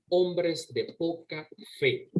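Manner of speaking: very slow, each word weighed: "Hombres de poca fe"; drawn-out stern rebuke, word by word; solemn rebuke. Acted. Jesus rebuking his doubting disciples, very slow and solemn. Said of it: "¿Y qué dice ahí?".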